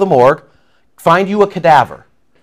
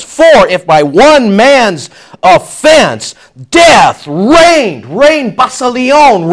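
loud, high preacher's proclamation; fiery sermon delivery; preaching at full force; 7 semitones higher, 4 dB louder, slower than usual. Acted. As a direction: thunder it from the pulpit. Preaching at full force on death reigning.

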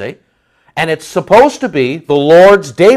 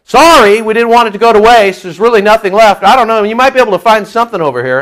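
higher, louder breezy excuse; offhand apology; 4 semitones higher, 4 dB louder than usual. Acted. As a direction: brush the latecomer off with a fake sorry. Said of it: "they're going".

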